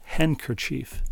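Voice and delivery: "Handkerchief" drawn out, slowly, long E on chief. Shown as a demonstration. Host slowly tests his own way of saying it. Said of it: "emphasis on the E sound".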